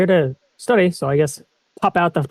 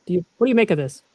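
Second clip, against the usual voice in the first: usual speaking voice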